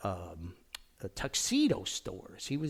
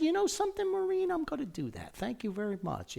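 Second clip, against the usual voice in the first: high eager acceptance; faster than usual. Retold or imitated. Young self eagerly accepting Maureen.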